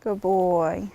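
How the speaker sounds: low, soft murmur to the horse; soft, low praise for the horse. Real, not acted.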